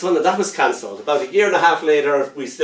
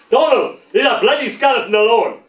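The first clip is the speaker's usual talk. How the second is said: higher, louder parade-ground bellow; booming army voice; 9 semitones higher, 5 dB louder than usual. Retold or imitated. Army dad yelling at his son from his room, booming. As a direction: bark it at the kid from the next room. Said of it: "my dad's army voice booms".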